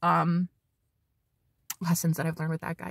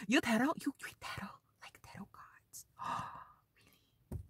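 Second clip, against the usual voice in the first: put-on hushed Ukrainian accent fading into a whisper; 4 semitones higher, 13 dB quieter than usual. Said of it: "forgive the accent".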